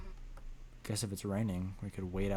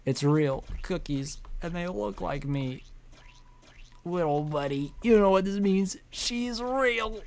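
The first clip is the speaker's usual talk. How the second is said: much higher, breathless thrilled voice; giddy character; 10 semitones higher, 5 dB louder, slower than usual. Acted. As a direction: awe building to squeal.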